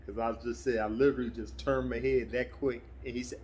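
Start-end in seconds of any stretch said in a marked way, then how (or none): none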